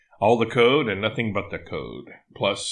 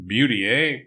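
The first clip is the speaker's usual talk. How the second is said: put-on half-hearted Canadian accent, a bit louder; 5 dB louder than usual. Quoting the movie Strange Brew, in a weak Canadian accent. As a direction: attempt a Canadian catchphrase, not quite managing the accent. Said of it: "I can't really do a Canadian accent".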